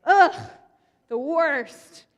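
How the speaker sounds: high, loud, drawn-out groan of frustration; hammed-up exasperated sigh; mock exasperation. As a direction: play up the frustration for the kids. Exasperated narrator, ignored again.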